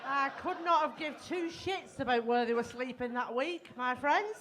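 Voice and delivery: loud exasperated rant; shouting. Real, not acted. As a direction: belt it out to the crowd. Ranting to the audience.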